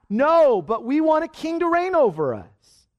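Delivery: high, loud defiant retort; shot back. Acted. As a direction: throw the refusal right back, insistently. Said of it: "and they shot back".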